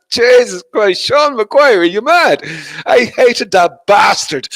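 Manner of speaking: hammed-up high, loud disbelief; mock outrage. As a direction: exclaim in pretend loathing of a friend. Mock outrage at friend's name.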